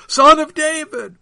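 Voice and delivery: very loud, high cry; shout. A narrator's reading. Crowd hailing Jesus on the road, loud shout. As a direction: shout it at full height. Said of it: "went up the shout".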